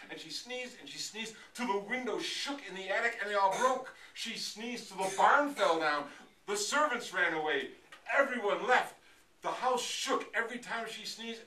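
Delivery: excited, escalating narration; building climax. A narrator's reading. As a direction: pile up the disasters with rising volume and pitch. Storyteller builds sneezing to climax.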